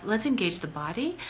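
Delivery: ordinary delivery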